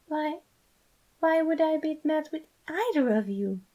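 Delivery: high and bewildered, asking gently; confused. A narrator's reading. Patton, baffled, asks why he'd be mad.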